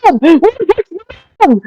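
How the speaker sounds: high-pitched, flustered outburst; frantic exasperation. Acted. Player's character trying to find a gnome, frantic. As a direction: blurt it out in a fluster.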